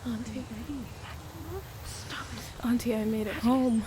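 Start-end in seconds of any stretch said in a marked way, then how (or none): 0.1-2.7 hushed, breathy plea; whisper. Acted. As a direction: whisper it so no one hears. Begging voices in her head.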